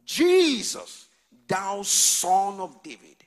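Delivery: high, loud, slow plea; blind man crying out. Acted. Blind beggar calling to Jesus, high and loud. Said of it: "Blind Bartimaeus".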